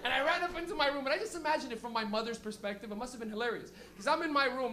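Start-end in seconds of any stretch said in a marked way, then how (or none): none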